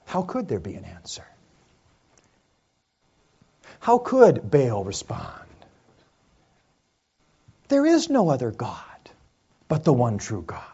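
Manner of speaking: very soft, low and spaced out; solemn hush. Real, not acted. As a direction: drop to a hushed, grave near-whisper. Solemn hush after dramatic climax.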